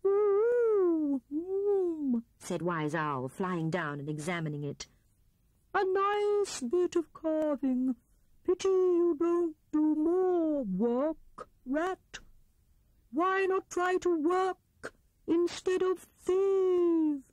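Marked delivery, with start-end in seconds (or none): 0.0-2.2 high hooting ooh; owl hoot. A narrator's reading. Owl examining Rat's carving, hooting. 5.7-17.2 put-on slow, high, hooting old owl; Wise Owl voice. A narrator's reading. Owl scolding Rat for thieving, slow and hooting.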